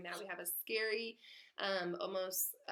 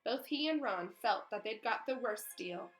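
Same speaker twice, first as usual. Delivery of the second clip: ordinary delivery, nothing marked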